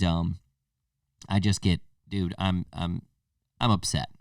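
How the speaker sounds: ordinary talk, nothing marked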